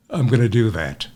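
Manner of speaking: normal talking voice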